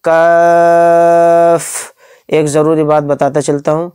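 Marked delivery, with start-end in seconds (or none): 0.0-1.9 قاف and کاف drawn out long, with a light f sound at the end; slow chanted کاف; stretched letter names. Shown as a demonstration. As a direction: hold each name for three counts. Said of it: "last میں فا یعنی English کا ایف آئے گا".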